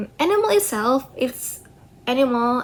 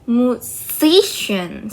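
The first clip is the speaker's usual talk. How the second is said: "Musician" drawn out slowly, leaning on "si"; stress on second syllable; 4 dB louder, much slower than usual. Shown as a demonstration. Showing how the suffix attracts stress, "Musician" slow with stressed "si". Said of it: "So the stress was on the C".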